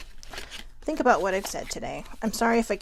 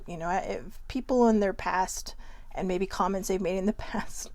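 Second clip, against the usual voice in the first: ordinary talk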